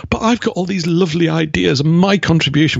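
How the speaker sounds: high, whiny mock protest; mock pleading newcomer. Mimicking newcomers pleading their contribution, in a high whiny voice. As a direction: parody their self-pitying plea. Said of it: "And they go".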